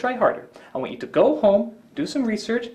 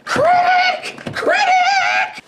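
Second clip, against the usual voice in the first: high, frantic yells for help; panicked screaming; 4 dB louder, much slower than usual. Acted.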